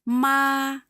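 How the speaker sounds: "Ma." held level and high, first tone; flat first tone. Shown as a demonstration. Teacher demonstrating first tone, flat high. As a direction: keep "Ma." on one high steady pitch. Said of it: "First tone is flat".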